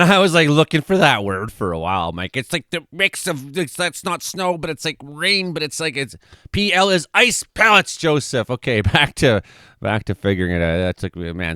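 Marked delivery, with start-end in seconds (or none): 2.4-8.5 goofy voice. Pretend. Goofy voice groping for a word.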